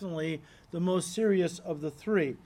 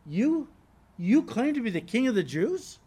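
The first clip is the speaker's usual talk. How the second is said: incredulous; 3 semitones higher than usual. Acted. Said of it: "Pilate was incredulous".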